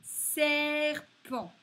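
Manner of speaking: teacher sounding out Serpent; clearly sounded-out word. Shown as a demonstration. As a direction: call out Serpent for the class. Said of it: "teacher sounding out a word".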